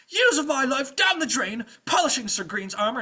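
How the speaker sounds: high, loud aggrieved squire rant. Acted. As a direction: list your grievances at full voice. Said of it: "he keeps on ranting".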